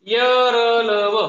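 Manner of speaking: यरलव slow and loud, syllables stretched; loud slow teacher's drill; drawn out drill. Shown as a demonstration. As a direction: chant यरलव slowly for the class. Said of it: "drill chant of letters".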